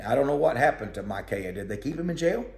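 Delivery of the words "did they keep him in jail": ordinary talk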